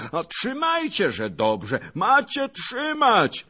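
high, very loud blustering bellow; shouting with all might. A narrator's reading. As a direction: boast and holler from atop the crowd. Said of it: "krzyczał co siły".

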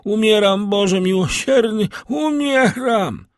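high, anguished dying plea; Barua crying out. A narrator's reading. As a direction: wail in terror of death, drawing out the end. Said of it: "zawołał Barua". (rozpaczliwy, wysoki krzyk umierającego)

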